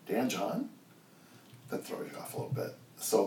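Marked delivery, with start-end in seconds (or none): none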